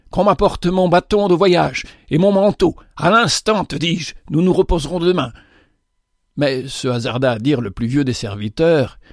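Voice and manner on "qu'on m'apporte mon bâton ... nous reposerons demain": higher, rushed commands, urgency building; urgent orders. A narrator's reading. Father hurrying his servants to fetch his staff, urgently commanding. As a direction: snap out orders, no time to lose.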